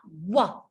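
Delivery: wah sliding out of rounded lips; W glide. Shown as a demonstration. Teacher modelling the W glide for learners, sliding out. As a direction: slide it out. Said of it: "feel it sliding out".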